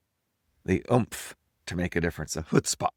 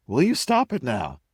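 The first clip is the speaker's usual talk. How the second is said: sharp, louder and higher, snapping at the dog; real scolding; 5 semitones higher, 5 dB louder than usual. Real, not acted. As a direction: tell the dog off for real, mid-sentence. Scolding the dog mid-video.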